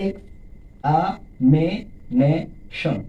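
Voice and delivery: "Examination" as x, আ, মি, নে, শন; five slow beats with gaps in examination; teacher counting the syllables of examination. Shown as a demonstration. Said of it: "পাঁচটি".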